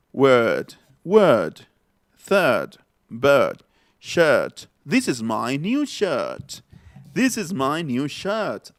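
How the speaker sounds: slow British demo with the R sunk back; British accent. Shown as a demonstration. Teacher demonstrates words for Spanish-speaking students, British accent. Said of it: "aquí se pronuncia".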